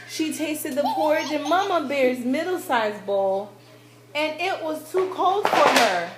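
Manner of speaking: ordinary delivery, nothing marked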